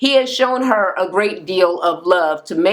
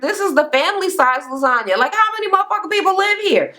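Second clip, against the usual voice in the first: much higher, fast disbelieving outburst; incredulous trainer; 12 semitones higher, faster than usual. Retold or imitated. Trainer shocked by family-size lasagna, much higher and fast. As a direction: shriek it in disbelief at the huge lasagna. Trainer quoted, outraged, in recap.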